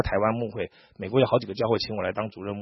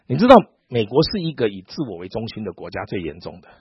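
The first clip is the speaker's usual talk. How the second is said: ordinary talk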